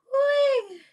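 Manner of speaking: very high, drawn-out laughter sigh; high whoop. Real, not acted. Host recovers from laughing, high whoop. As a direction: let out a high squeal after laughing.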